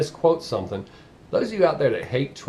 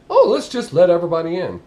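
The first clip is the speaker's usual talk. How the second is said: higher, louder mock of opponents; mocking quote; 4 semitones higher, 6 dB louder than usual. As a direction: parody a naive opinion, pitched up. Mocking the opposing opinion.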